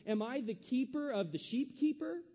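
high, mocking twist on keeper; hammed-up sarcastic Cain. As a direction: sneer the wordplay at God. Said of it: "See the sarcasm he has with God?".

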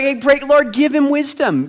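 high-pitched mock prayer; mock plea. Onlooker praying for the scowling pastor, high mock plea.

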